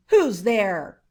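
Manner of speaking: a loud, startled call into the dark; frightened cry. A narrator's reading. Old man woken in the dark, calling out: startled loud cry. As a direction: shout it out, suddenly scared awake.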